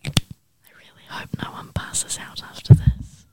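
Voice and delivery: whispering. Real, not acted. Whispering during breath-hold contest.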